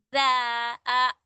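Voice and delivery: जा, आ stretched very slowly; drawn-out aa. Shown as a demonstration. Teacher stretching the vowel of जाल. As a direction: drag out जा and आ slowly. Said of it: "आ का जो sound आ रहा है".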